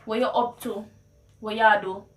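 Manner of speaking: casual Patois question; Jamaican Patois. Shown as a demonstration. Checking on a friend, in Jamaican Patois. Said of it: "how you would translate".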